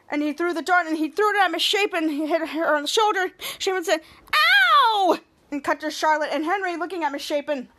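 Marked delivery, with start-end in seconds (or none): 4.3-5.2 sudden high squealed "ow" as the hurt teacher; teacher's shrill yelp of pain. Retold or imitated. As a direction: squeal it like you've just been jabbed. Teacher hit by a dart.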